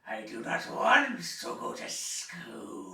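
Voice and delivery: loud, high, very slow "I do not want to go to school"; exaggerated enunciation. Shown as a demonstration. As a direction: stretch every word of "I do not want to go to school" with full force. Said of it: "Try to get your whole face in there".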